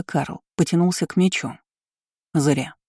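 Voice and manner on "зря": cool, curt remark; unmoved, chilly warning; coldly. A narrator's reading. Steward to captain reaching for his sword, coldly. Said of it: "холодно сообщил ему Айнан".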